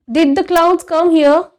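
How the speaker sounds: put-on hesitant boy asking. A narrator's reading. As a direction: push the question out at last.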